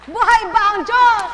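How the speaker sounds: loud, high rallying shout; shouted call. Real, not acted. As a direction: rouse the crowd with the call. Preacher shouts to congregation. (malakas na sigaw sa kongregasyon)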